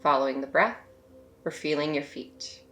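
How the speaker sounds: soft, unhurried meditation voice with long pauses; hushed, spacious meditation guidance; guided meditation. Real, not acted. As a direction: gently guide them to settle and notice, leaving space between phrases.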